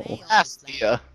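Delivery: put-on slow, drawn-out Polish accent. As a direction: copy the clip in a Polish accent. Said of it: "Sorry, Polish accent".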